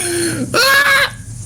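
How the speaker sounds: high shrieking cackle at his rival; shrill scornful laugh aimed at the rival. Real, not acted. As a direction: burst out in a high mocking laugh. Loud laugh at opponent.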